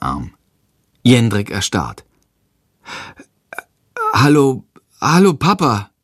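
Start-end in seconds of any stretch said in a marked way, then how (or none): 4.1-5.9 mildly stunned, halting hello; a boy caught off guard seeing his dad; a touch of startled hesitation; flustered. Acted. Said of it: "sagt er verdattert".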